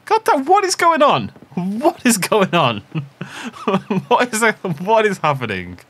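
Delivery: loud, high disbelieving yell; exasperated shout. Real, not acted. Only crayfish keep biting.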